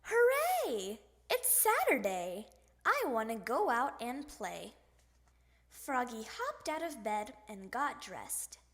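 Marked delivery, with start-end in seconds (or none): 0.0-4.7 excited yell. Acted. Froggy waking to a Saturday, excited yell. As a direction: yell it out with glee. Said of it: "Froggy woke up and yelled".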